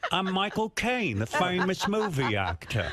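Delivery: slow, high, hammed-up Cockney accent; Michael Caine. Host impersonating a British star, slow Cockney accent. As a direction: draw out a mock London movie-star accent. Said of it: "Oh, that's Cockney".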